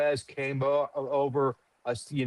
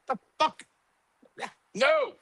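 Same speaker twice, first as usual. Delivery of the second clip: much higher, scoffing "the fuck? yeah, no"; disgusted reaction; 14 semitones higher than usual. Retold or imitated. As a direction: pull a face and reject it, voice jumping high. Customers tasting bad cigar.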